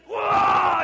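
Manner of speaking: sudden loud high Whoa; mock angry shout. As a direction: burst out at the lost child. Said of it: "And then comes the anger".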